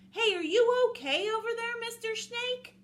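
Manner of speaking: high, loud sing-out; concerned wolf checking in loudly; calling across. Acted. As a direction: call to someone far off. Wolf calls across to snake.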